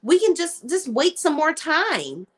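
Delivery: imitating an upset wife, high-pitched; upset pleading. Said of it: "now she's all upset".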